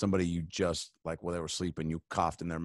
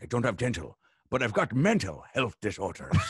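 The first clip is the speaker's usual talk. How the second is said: pirate voice; 4 semitones higher than usual. Pretend. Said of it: "he's like".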